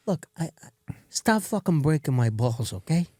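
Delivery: a lightly voiced Italian mob boss, irritated; mild mob boss rant. A lightly voiced mob boss telling his crew to quit it. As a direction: grumble like a fed-up mobster telling him off. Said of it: "I wanna be in an Italian mob film".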